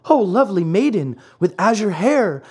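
high puppet voice calling in appeal; crying out pleadingly. Acted. Pinocchio calls to the vanished maiden, pleading cry. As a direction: implore her, pitched well up. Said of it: "cried Pinocchio".

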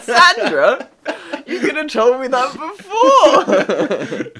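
loud, high, laughing protest; hammed-up indignant shout through laughter; mock outraged yell. Retold outburst at the receptionist friend.

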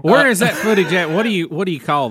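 laughing through the question; real laughter while asking. Real, not acted. Laughing at joke.

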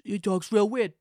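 half-hearted attempt at a politician's odd speech; terrible impression. Host badly mimicking how a politician talks, halting impression. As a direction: try the weird talker and fumble it. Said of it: "that was a terrible, uh, impression".

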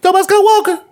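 mock exclaim. Mocking exclamation.

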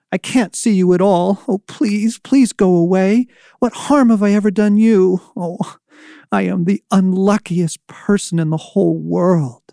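put-on high, pleading, frightened boy; pleading fearfully. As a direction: beg it to leave, near tears. Terrified boy pleads in dark.

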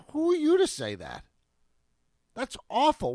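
high, loud indignant outburst; outraged. Real, not acted. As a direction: sound scandalized and loud. Outraged at resort owner.

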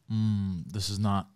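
low, soft thinking aloud; uneasy low musing. Retold or imitated. Man seeing three cop cars outside, quiet low unease. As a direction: drop it low and quiet, as a worried thought. Voicing his uneasy thought in retelling.

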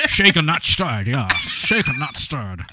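slow, hammed-up Scottish spy impression; Sean Connery. Mocking the storyteller's bad accent, Sean Connery impression. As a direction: do a slurred Scottish film-star spy. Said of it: "Sean Connery just showed up there".

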